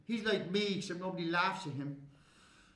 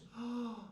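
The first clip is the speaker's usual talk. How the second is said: soft gasp of relief; relieved gasp; 3 semitones higher, 7 dB quieter than usual. Acted. Relief while peeing.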